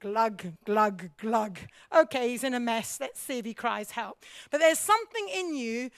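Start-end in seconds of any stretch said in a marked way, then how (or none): 0.0-1.6 low glug glug glug; mock sinking gurgle; sinking glug noises. Mimicking someone sinking.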